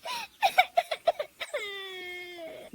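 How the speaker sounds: high, drawn-out wailing sob; hammed-up weeping; crying. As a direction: break down and bawl. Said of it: "वो रोने लगती है".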